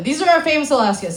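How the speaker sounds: ordinary talk, nothing marked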